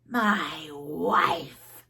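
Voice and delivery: a snake calling her back; a mild hissing call; hissed. Acted. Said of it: "it hissed".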